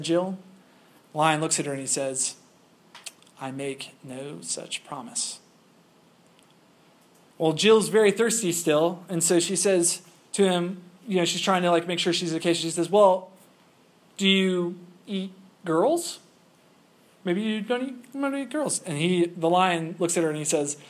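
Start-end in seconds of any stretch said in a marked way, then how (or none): 3.4-5.4 very soft, slow, measured refusal; understated lion, each word placed slowly and quietly; stern lion. A narrator's reading. Lion refuses the girl's request. 12.9-18.8 lightly acted wary girl feeling out the danger; nervous question. A narrator's reading.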